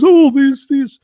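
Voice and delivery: high, loud mock exclamation; mocking reductionist. Mocking reductionists who offer one-fix nonsense, high-pitched mock excitement. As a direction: parrot a simplistic answer, loud and high-pitched.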